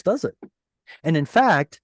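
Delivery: ordinary talk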